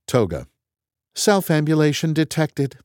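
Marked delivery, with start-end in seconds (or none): 1.1-2.8 high robotic announcement; computer voice. Acted. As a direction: announce it like a machine. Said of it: "says the computer".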